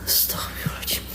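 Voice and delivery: hushed, breathless prayer under the breath; scared whisper in the dark; frightened whisper. Real, not acted. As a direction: whisper it so nothing hears you. Scared in dark, hearing crying.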